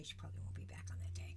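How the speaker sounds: secretive low whisper. Real, not acted. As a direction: tell it like a secret. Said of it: "just between you and me".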